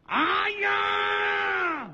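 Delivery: high, loud drawn-out cry of pain; a queen's hammed-up moan of agony; pained wail. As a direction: wail out as if the heart aches. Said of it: "不住的呻吟".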